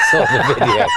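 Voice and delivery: blurted through a laugh; cracking up mid-sentence. Real, not acted. Teasing reply, bursting out laughing.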